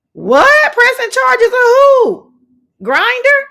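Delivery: high, loud incredulous; shocked disbelief. Real, not acted. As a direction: yelp it in disbelief. Reacting to comment read out.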